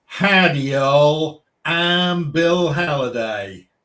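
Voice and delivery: loud, slow amateur Texan drawl; showing off a Texan accent; put-on Texan accent. Shown as a demonstration. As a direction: announce yourself in a big slow Texan accent. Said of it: "do my Texan accent for you".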